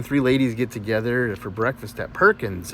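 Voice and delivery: usual speaking voice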